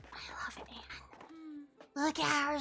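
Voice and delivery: slow breathy whisper of love; adoring whisper. Real, not acted. Whispering to the stream about cute Anne, adoring. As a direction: sigh it out softly, smitten. Gushing over a cute character.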